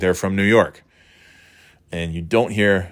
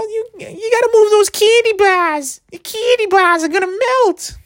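very high, squeaky caricature of a New Hampshire woman; weaselly New Hampshire squeal, worked up over nothing; New Hampshire accent; 3 dB louder than usual. Retold or imitated. Customer warning cashier about melting candy, squeaky New Hampshire accent. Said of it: "the woman in front of me is like".

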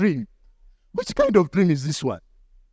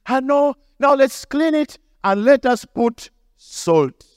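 mother raised high, slowly coaxing; a hint of mom sweetly coaxing; mom's voice; 7 semitones higher, slower than usual. Retelling mom before salting the wound, mildly coaxing.

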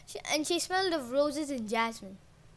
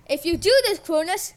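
loud threat; 4 semitones higher, 8 dB louder than usual. A narrator's reading. Uranus threatening.

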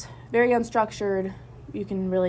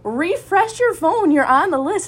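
excited urging; 10 semitones higher, 7 dB louder than usual. Retold or imitated. Friend urging her to check.

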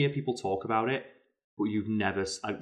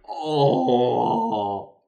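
crestfallen oh; 3 semitones higher, 7 dB louder than usual. Retold or imitated. Deflated drunk guy.

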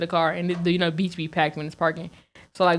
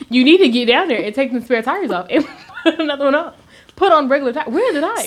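laughing scold; 7 semitones higher, 5 dB louder than usual. Retold or imitated. Scolding date about tires.

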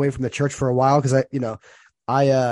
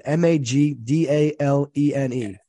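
each letter of the name said slowly and distinctly; slow careful spelling of Magdalene; spelled out slowly; slower than usual. Shown as a demonstration. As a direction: spell it out letter by letter for a listener taking notes. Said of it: "her last name is Magdalene".

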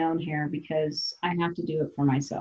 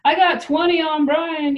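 excited betting; 9 semitones higher, 4 dB louder than usual. Retold or imitated. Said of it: "the other dudes weigh in".

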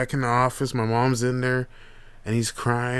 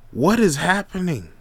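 startled; 6 semitones higher than usual. Retold or imitated. Uncle reacting to nephew's phrase, startled high. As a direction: jump up in pitch, caught off guard.

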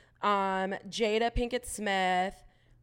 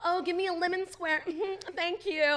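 high-pitched smitten mimicry; mimicking a fan; 8 semitones higher than usual. Co-host mocking his morning scrolling, in a high imitation. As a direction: pitch it up, teasing a gushing fan. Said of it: "looking at this guy's like".

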